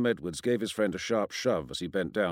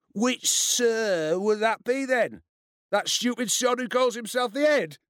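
high, loud, scornful roar; 11 semitones higher, 4 dB louder, slower than usual. A narrator's reading. Bully scoffs at threat of telling Sir, roaring. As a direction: roar it mockingly, opening drawn out. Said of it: "Ian roared".

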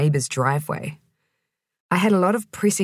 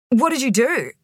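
croaked; 8 semitones higher than usual. A narrator's reading. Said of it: "I croaked".

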